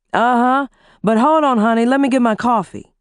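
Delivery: easygoing husband on the phone. Acted. Husband voiced by narrator.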